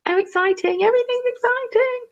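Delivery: very high excited; gushing. Real, not acted. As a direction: gush over it.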